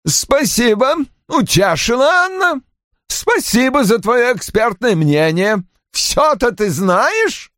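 high-pitched mocking thanks; biting sarcasm. A narrator's reading. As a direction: sneer at the know-it-all daughter. Roy sarcastically thanks his daughter. (язвительно, с издёвкой)

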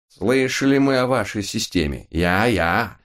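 a hint of German accent; lightly voiced parody of foreigner; mixed foreign accent. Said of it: "пародируя вольным миксом все языки".

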